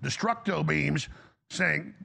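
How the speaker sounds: ordinary delivery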